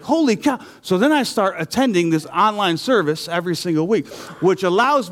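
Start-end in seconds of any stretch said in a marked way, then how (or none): 0.0-0.6 amazed. Retold or imitated. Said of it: "I'm like".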